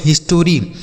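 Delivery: "हिस्टोरी" as his-to-ree, with an extra O; common misreading. Shown as a demonstration. Teacher imitating how many people misread it, "हिस्टोरी" in three syllables. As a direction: put a full TO syllable inside "हिस्टोरी". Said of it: "बहुत सारे लोग होते हैं इसे पढ़ते हैं".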